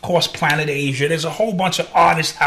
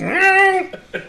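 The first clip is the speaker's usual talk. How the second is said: high drawn-out meow; cat meow; 12 semitones higher than usual. Retold or imitated. Cat imitation continues.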